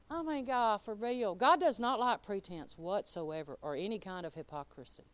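ordinary delivery

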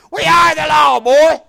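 loud mock threat of a small-town lawman; mock lawman shout. Preacher imitating lawmen shouting at him.

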